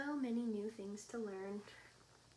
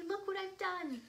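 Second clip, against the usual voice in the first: put-on high, loud, upset child's cry; 8 semitones higher, 5 dB louder than usual. A narrator's reading. Lily shows Mom what she's done, crying out. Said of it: "cried Lily".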